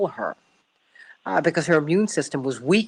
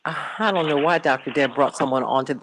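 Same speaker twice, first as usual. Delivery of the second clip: ordinary talk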